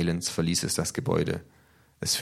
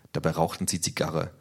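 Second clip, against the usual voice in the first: plain reading voice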